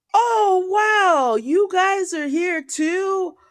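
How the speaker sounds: high-pitched, drawn-out mock amazement; feigned surprise. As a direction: badly fake a chance encounter with friends. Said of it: "he pretends to run into his friends".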